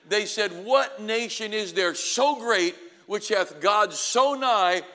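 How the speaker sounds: loud, high, driving sermon voice; preaching at full force. Acted. Preacher rousing graduates about their vision, full-force preaching. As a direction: preach it out to the rafters.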